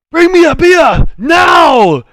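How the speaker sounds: high, full-force bellow; intimidating shout. Acted. Barbarian intimidates the barmaid for beer, shouting. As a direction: roar to terrify the barmaid. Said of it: "roll me an intimidation check".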